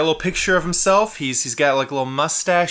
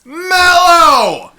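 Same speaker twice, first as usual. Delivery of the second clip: "mellow!" sung out high and loud, drawn long; all caps shout; 13 semitones higher, 9 dB louder than usual. Shown as a demonstration. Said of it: "All caps".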